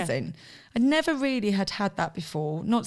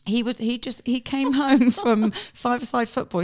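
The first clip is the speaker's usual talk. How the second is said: laughing through it; 4 semitones higher, 6 dB louder than usual. Real, not acted. Laughing while retelling story.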